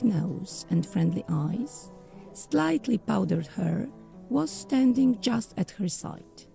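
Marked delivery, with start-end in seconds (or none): none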